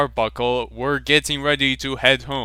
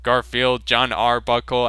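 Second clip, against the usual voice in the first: plain reading voice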